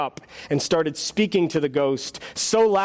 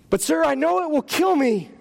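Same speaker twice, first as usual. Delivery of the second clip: high, panicky pleading voice; a scared ghost begging the angel to stop; frightened ghost; 9 semitones higher than usual. Acted.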